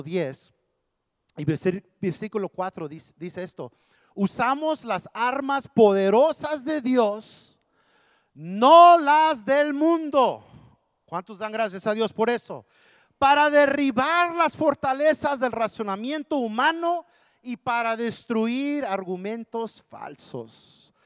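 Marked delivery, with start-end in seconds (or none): 4.1-10.4 loud, high, drawn-out proclamation; ringing pulpit reading; preaching scripture. Acted. Proclaims scripture at full force. 13.2-20.9 loud, high, drawn-out proclamation; ringing pulpit reading; preaching scripture. Acted. Proclaims scripture at full force.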